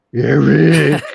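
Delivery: loud teasing call of a name; jeering shout across the room; mock yell. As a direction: yell the name to tease him. [name] teasing Eric by name.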